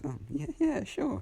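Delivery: high, rising eager reply; girl squeaking yes to Izuku; excited squeak. Acted. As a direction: squeak with delight. Excited to join him.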